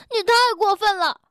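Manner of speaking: 高声快速地喊; 气得带哭腔地嚷. Acted. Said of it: "跳跳这下真急了". (跳跳急了快速高声喊)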